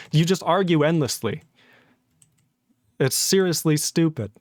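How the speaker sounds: ordinary delivery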